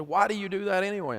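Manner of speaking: a touch of the outraged wife demanding answers; indignant wife. Retold or imitated. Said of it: "she said".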